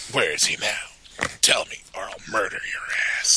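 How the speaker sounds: sinister whispering interrogator; Dorn. Acted. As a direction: menace them in a husky whisper. Continues Dorn's voice, threatening.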